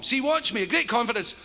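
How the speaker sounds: ordinary delivery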